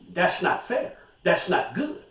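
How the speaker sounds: normal talking voice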